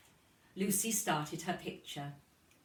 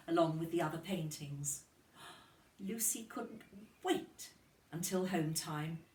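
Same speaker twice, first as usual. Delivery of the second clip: usual speaking voice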